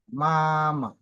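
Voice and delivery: MAMA with first syllable held high and level, then dropping; first-tone example. Shown as a demonstration. Teacher giving a first-tone word, MAMA high and drawn out. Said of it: "Comme un exemple de ma".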